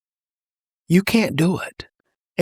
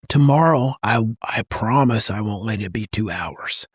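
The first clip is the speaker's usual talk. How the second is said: low, calm, steady dad voice; gentle reassurance; 8 semitones lower than usual. Acted. Dad apologizing to his child about screen time, calm and reassuring.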